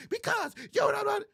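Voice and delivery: high-pitched mock shouting; loud, shrieked comeback; arguing, shouting over him. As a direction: yell back as the wife in a staged row. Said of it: "What I mean by arguing".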